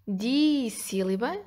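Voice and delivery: "dissílaba" with a long, drawn-out "di"; slow "dissílaba", opening syllable held; first syllable stressed. Shown as a demonstration. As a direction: stretch the "di" in "dissílaba". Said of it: "di, começa com D".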